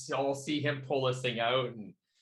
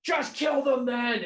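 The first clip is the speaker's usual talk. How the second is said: high, loud, drawn-out shout; acted shout at full pitch; frustrated yell; 11 semitones higher, 6 dB louder, slower than usual. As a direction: yell the order in exasperation. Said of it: "he just yells".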